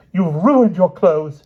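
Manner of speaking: high, loud, slow furious line; the queen furious at her sons; hissed fury. Acted. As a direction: spit it out in rage. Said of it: "she hissed".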